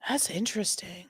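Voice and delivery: hushed whisper. Real, not acted. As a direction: whisper it to yourself. Reactor whispers aside.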